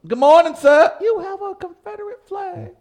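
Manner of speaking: sweetly fake-polite greeting; mock polite greeting. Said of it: "It was like".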